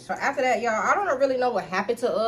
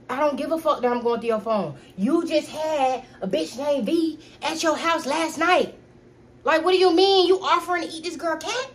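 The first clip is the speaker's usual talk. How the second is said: heated confrontation; 5 semitones higher, 4 dB louder than usual. Retold or imitated. Confronting boyfriend over cheating.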